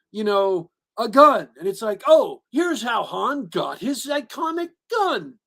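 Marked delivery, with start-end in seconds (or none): 2.1-5.4 high, slow, hammed-up marvelling; sing-song fake wonder; mock amazement. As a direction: gush sarcastically at an obvious origin moment. Mocks forced prequel origin moments.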